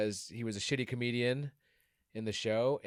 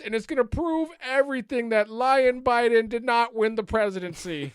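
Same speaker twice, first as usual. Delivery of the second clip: Mike Lindell impression; 11 semitones higher, 7 dB louder than usual. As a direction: hype it like a pillow salesman on TV. Impersonating Mike Lindell announcement.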